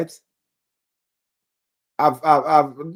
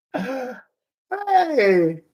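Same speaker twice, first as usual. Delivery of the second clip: ordinary delivery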